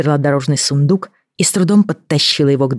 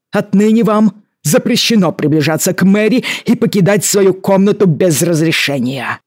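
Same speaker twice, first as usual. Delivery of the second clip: indignant, raised angry lecture; stern angry governor; 3 semitones higher than usual. A narrator's reading. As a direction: scold her like an outraged guardian. Governor scolds ward. (гневная отповедь губернатора)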